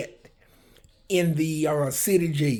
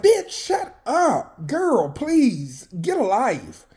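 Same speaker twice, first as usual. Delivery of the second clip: sassy dismissal; 7 semitones higher, much slower than usual. Retold or imitated.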